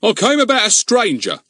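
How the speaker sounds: loud, indignant village constable; blustering policeman. Acted. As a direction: bluster like a suspicious bobby. Narrator voices Goon.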